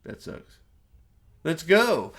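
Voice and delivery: low, understated mock apology; half-hearted sorry, trailing into near-silence; offhand mock sympathy. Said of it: "And she's like".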